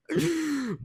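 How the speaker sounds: high giggling burst; real laugh. Real, not acted. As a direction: break into laughter at his own bragging. Laughs at own boast.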